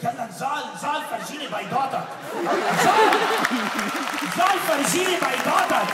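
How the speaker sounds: loud, urgent Arabic goading; mock yell egging her man on; girlfriend egging him on. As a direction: shout at him to get out and fight. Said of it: "and she's like".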